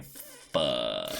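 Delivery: Sanji muttering. Retold or imitated. Fan imagining Sanji's reaction to the news, low muttered curse. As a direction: mutter it like all the air left him. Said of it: "it's like he's saying".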